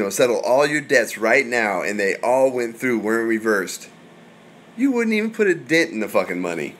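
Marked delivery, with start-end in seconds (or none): none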